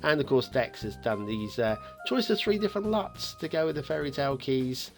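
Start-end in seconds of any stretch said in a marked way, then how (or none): none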